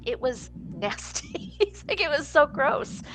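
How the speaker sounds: drawn-out, high-pitched "nasty" with a laugh; laughing through retold disgust; amused disgust. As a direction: slowly savor how revolting it was. Retold director's disgust at the smell.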